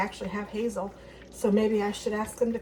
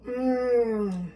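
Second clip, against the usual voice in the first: appreciative mm of taste; savoring delicious food; 2 semitones higher than usual. Real, not acted. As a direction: hum with pleasure at the bite. Tasting the salad.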